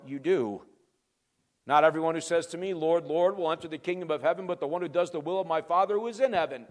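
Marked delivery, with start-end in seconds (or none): 1.7-6.7 fast, run-together scripture recap; brisk recital in one breath; rapid re-reading. Real, not acted. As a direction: race through the familiar verse without pausing. Quick re-reading of same verse.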